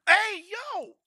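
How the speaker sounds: high, loud imitated "Hey, yo!"; friends calling out. Imitating people shouting slang at him, high and loud. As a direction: shout it like a pal hailing. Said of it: "I just said you got clapped".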